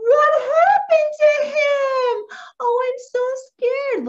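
high, gasping mock alarm; hammed-up scare for a child; pretend fright. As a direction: squeal it in exaggerated dread. Pretends fear while reading aloud.

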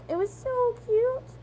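cooing. Acted. Mom gushing about chick.

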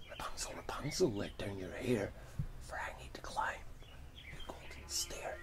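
hushed whispered chant; whispered call. Retold or imitated. Prince overhears witch's call.

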